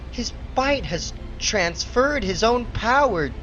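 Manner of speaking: high, excited and breathless; amazed. Acted. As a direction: marvel at what you can do. Discovers his powers.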